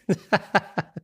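high burst of laughing; real laughter. Real, not acted. Laughing at the dad's reply, high real laugh. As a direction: crack up at your own story. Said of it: "Pissing myself laughing".

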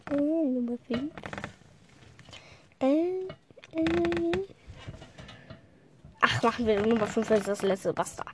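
ordinary delivery

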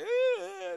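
a sudden high wailing sob, played for laughs; half-joking high cry sound; mock ugly cry. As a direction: imitate a messy ugly cry. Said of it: "those ugly cries".

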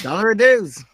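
high, loud bad Australian accent; overdone fake Australian accent; crappy Australian accent. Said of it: "That's a really crappy Australian accent".

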